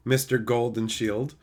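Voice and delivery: ordinary talk, nothing marked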